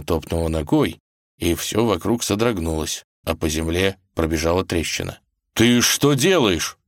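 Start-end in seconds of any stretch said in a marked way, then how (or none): 5.5-6.8 alarmed, raised protest; slow, startled reproach; frightened. Acted. Ivan sees the ground crack under her stamp, frightened. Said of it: "испугался Иван".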